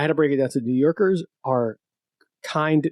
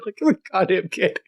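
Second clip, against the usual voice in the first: ordinary talk, nothing marked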